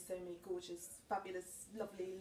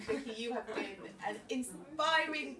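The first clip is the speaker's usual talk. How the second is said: usual speaking voice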